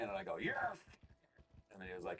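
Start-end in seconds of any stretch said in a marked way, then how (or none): 0.4-0.8 a sudden leap way up in pitch on one triumphant Yes; hammed-up wrestling boss exclamation; Vince McMahon impression. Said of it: "Give me your best Vince McMahon".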